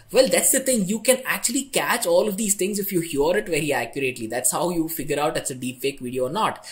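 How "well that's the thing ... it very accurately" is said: usual speaking voice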